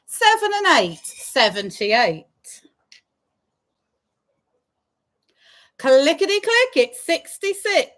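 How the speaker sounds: sing-song bingo number calls; drawn-out call then quick repeat; bingo caller. Acted.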